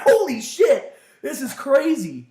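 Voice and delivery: high, loud amazed exclamation; hammed-up disbelief, slowing on the last words; acted astonishment. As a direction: exclaim it like a stunned viewer. Viewer's stunned reaction, acted.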